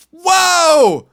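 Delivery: high shouted sarcastic whoa; mock amazed whoa. Comic mocking how easy the job was, sarcastic shouted whoa. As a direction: fake awe at a trivial task. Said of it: "So complicated".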